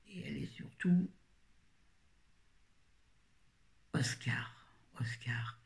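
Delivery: unvoiced whisper of a secret name; hushed whisper. Real, not acted. She whispers the heroine's secret name to viewers, hushed. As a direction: breathe the name Oscar out.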